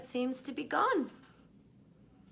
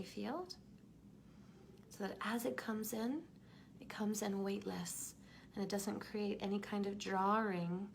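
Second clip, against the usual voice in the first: guided meditation. Acted. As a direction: guide them gently back into the body.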